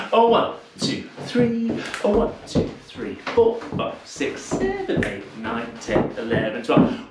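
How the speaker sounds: slow rhythmic count-in over music; calling dance counts. Shown as a demonstration. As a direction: call the steps in time like a dance teacher. Calling counts while dancing.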